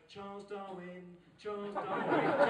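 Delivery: put-on slow, drawn-out child's answer; imitated toddler answer. Two-year-old answering dad's turtle quiz, slow recital. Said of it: "And he goes".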